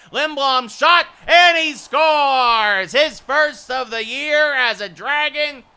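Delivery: loud, high, stretched goal call; excited goal call. Acted. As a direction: shout the goal, drawn out.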